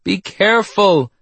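high, anxious warning; alarmed nurse calling out; worried cry. A narrator's reading. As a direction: call out a warning, pitch high. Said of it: "Nurse Marie cried".

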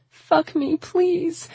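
very high, desperate begging; crying plea. A narrator's reading. Woman pleading with the man, crying out. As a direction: beg tearfully in a high voice. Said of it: "I cried".

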